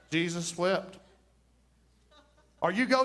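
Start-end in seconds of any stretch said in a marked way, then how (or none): 0.1-1.0 deadpan the shortest verse; Jesus wept read low and flat as a joke; mock solemn. Shown as a demonstration. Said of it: "You read that one verse".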